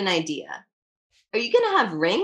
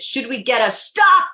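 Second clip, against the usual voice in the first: loud; 6 dB louder than usual. Acted. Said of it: "Mom said it so loud".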